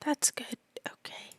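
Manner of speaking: whisper. Real, not acted. Player whispers in reply.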